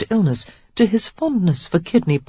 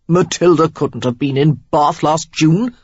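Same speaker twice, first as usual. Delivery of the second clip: arguing; 5 dB louder than usual. A narrator's reading. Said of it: "the third argued".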